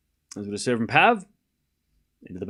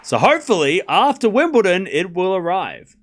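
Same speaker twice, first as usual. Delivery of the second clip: excited; 6 semitones higher, 5 dB louder than usual. Real, not acted. Excited about arriving gift.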